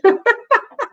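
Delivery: short high giggle; embarrassed giggle. Real, not acted. Laughing about tiny bladder.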